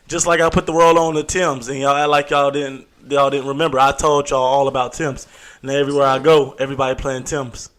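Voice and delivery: ordinary talk, nothing marked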